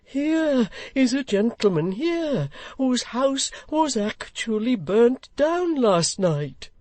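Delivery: slow, high call up the stairs. Acted. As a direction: call up to the landing, drawn out.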